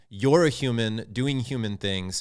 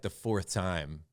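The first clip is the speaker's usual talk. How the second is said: usual speaking voice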